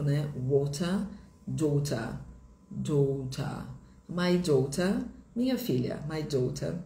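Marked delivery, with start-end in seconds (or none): 0.4-3.6 British "Water" and "daughter", crisp T, no final R; word by word British demo, "daughter" ending in "tah"; sotaque britânico. Shown as a demonstration. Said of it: "muda no inglês britânico". 4.1-5.0 British "My daughter", crisp T, no R; sotaque britânico. Shown as a demonstration. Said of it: "muda no inglês britânico". 6.1-6.7 British "My daughter", crisp T, no R; sotaque britânico. Shown as a demonstration. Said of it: "muda no inglês britânico".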